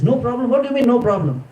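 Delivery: incredulous. Retold or imitated. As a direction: throw his words back at him in shock. Sita echoes Lakshman in disbelief.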